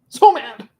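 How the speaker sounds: high, loud burst of annoyance. Real, not acted. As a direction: blurt it out. Loud repeat after whisper.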